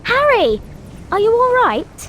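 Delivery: calling out worried. Acted. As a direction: call out to check he's unhurt.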